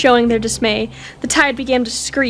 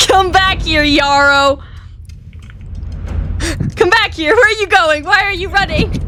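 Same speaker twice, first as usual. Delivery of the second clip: angry shouting; 6 semitones higher than usual. Acted. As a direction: call after him as he runs. Chasing a fleeing suspect.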